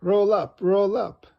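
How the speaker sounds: loud, high fairground call; barker's call. Acted. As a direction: call the crowd in like a fair barker. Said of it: "cries Miss Rabbit".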